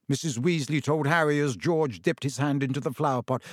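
plain reading voice

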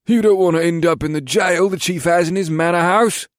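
high, loud soldier's warning; emphatic warning. A narrator's reading. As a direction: warn him hard about the consequences.

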